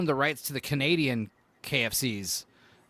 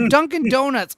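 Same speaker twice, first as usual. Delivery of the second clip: Dunkin' Donuts called out much higher and louder, relishing it; fun to say; 11 semitones higher, 10 dB louder than usual. Shown as a demonstration. Host relishing the brand name, loud announcer call. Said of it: "it's fun to say".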